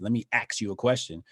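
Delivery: "ask" said "axe" in passing. Retold or imitated. Son talking to his mom, "ask" pronounced "axe". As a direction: say "ask" with KS at the end. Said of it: "might say axe instead of ask".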